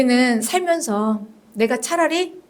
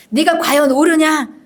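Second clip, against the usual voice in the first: higher, louder stern question; challenging God; 4 semitones higher, 5 dB louder than usual. Acted. God questioning angry Jonah, stern challenge. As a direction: confront them with a forceful question. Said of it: "두 번째 또 물어보십니다".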